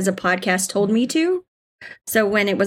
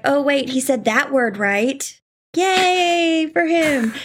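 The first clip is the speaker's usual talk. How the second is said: mock cheer; 6 semitones higher, much slower than usual. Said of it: "I was like".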